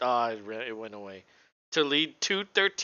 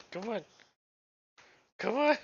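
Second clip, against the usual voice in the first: coaxing dog voice; 9 semitones higher than usual. Real, not acted.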